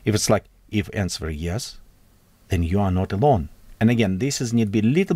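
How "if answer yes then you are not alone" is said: "If answer yes," then a held beat before "then you are not alone"; a suspenseful gap after "yes"; pause after yes. Shown as a demonstration. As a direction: let a pause sit after "yes" to build mystery. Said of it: "I want to put a little pause".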